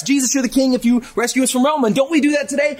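a hammed-up deal with God; voicing people who bargain with God over their troubles; bargaining believer. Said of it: "They wanted Jesus to be the king".

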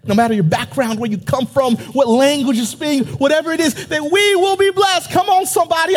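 climbing preacher's cadence; preaching. Acted. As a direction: build the call up to a shout of praise. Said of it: "I feel like preaching".

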